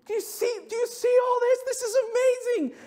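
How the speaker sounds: imitating Pastor Josh's delighted shout; excited shout. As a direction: shout it like a thrilled friend. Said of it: "And he goes".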